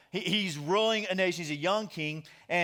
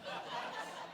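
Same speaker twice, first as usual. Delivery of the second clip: quiet breathy chuckle; soft laugh; 13 dB quieter than usual. Real, not acted. Preacher laughing at his own vacation joke. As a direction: let a small laugh slip out.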